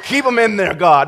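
loud, high plea to heaven; comic frantic begging at full volume; mock desperate prayer. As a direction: cry out a hammed-up panicked prayer. Joking panicked prayer over door frame.

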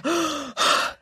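acted winded gasps; gasping for breath. A narrator's reading. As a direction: heave for air mid-sentence. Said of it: "She struggled for breath".

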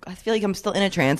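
ordinary talk, nothing marked

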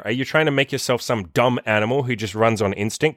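ordinary delivery

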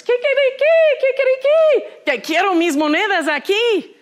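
high, loud defiant crowing; rooster crowing. Acted. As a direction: crow it out like a furious little rooster. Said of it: "So he's crowing".

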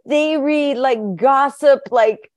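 high, loud incredulous rant; playfully outraged at the idea; excited. Real, not acted. Mocking vacation reading, incredulous.

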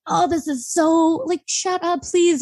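mock mortified pleading; embarrassed plea. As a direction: squirm as you beg them to stop. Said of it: "you're just like".

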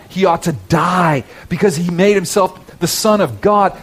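high, driving pulpit delivery; ringing sermon voice, acting out the exchanges; impassioned preaching. Real, not acted. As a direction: proclaim it with rising fervor. Preaches the passage at full force.

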